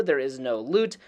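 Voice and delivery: ordinary talk, nothing marked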